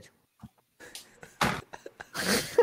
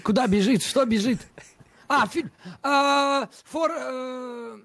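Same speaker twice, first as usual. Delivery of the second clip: louder, higher, racing to guess; frantic shouting guesses; 7 semitones higher, 3 dB louder than usual. Real, not acted. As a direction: shout out guesses against the clock.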